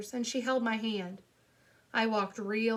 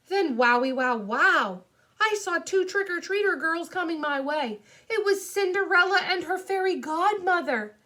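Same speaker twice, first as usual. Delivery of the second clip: put-on loud, high, excited kid narrator; thrilled storytelling; 7 semitones higher, 6 dB louder than usual. A narrator's reading. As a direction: gush over an amazing sight. Narrator thrilled at spotting trick-or-treaters.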